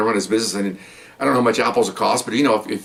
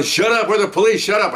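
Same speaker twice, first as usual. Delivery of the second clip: retold yell; 9 semitones higher than usual. Officer yelling at a struggling suspect, retold yell. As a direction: re-enact yelling a command in a high voice. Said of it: "And I'm yelling".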